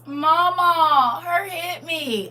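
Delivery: put-on high, drawn-out toddler whine; whining small child. Grandchild tattling to grandma on the phone, whining. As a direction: imitate a tattling little one, high and slow. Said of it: "She started whining".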